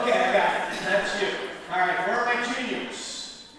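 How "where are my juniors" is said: ordinary delivery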